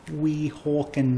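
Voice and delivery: ordinary talk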